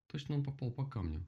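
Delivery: usual speaking voice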